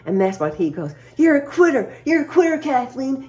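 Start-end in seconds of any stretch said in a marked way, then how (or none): 1.2-3.3 mocking husband. Wife imitating husband jabbing at her, high taunting jeer. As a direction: needle her like a jeering bully.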